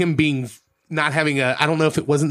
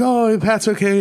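higher-pitched, light mock African accent; imitated African accent; 6 semitones higher than usual. African cab driver accepts the destination, lightly imitated accent. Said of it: "very thick African accent".

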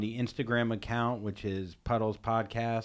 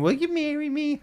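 mocking whine; 3 dB louder than usual. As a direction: whimper the proposal mockingly. Mocking the voicemail proposal.

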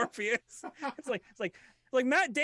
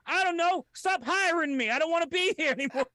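much higher and louder, rushed; mock Gervais; 9 semitones higher, 4 dB louder than usual. Gervais begging not to be hired, high and loud. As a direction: blurt it out, pleading to be fired.

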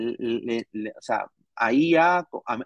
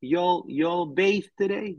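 slow, raised Southern US drawl; Southern US accent; 4 semitones higher, much slower than usual. Shown as a demonstration. Demoing Florida Southern US speech to chat, slow Southern accent. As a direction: put on a Southern US accent and take your time. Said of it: "El sur tiene un estilo al hablar el inglés".

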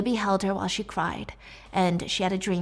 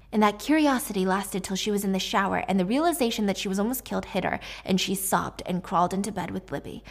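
ordinary delivery, nothing marked

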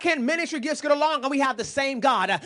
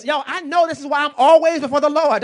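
loud preaching burst; fervent preacher shout; 4 semitones higher, 8 dB louder than usual. Real, not acted. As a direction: drive it home at full voice. Preacher building to full force.

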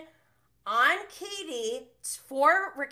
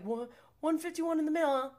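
quick, higher-pitched mimicked comeback; imitated high energy; 6 semitones higher, faster than usual. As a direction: act out his energetic return, rushed. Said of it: "comes back with, like, a lot of energy".

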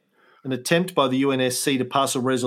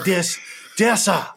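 higher put-on Chinese accent on "dear sir"; Mao accent; 4 semitones higher than usual. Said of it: "in a Mao accent".

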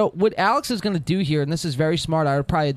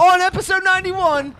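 high, loud hype; excited; 12 semitones higher, 6 dB louder than usual. Real, not acted. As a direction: build up the hype. Hyping the live moment.

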